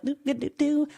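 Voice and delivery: high little carefree doo-doo hum. Retold or imitated. Mom still fine at the fair, breezily carefree. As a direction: play blissfully unbothered, high and light. Mimics herself carefree, unbothered.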